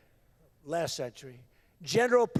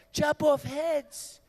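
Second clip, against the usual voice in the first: slow emphatic; 4 semitones higher, much slower than usual. Real, not acted. Dramatic repetition.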